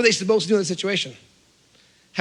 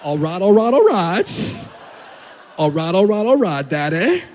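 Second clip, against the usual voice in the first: loud Southern impression, last word swung up; Matthew McConaughey; 4 dB louder than usual. Naked toddler greeting Dad, Southern McConaughey accent. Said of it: "Matthew McConaughey".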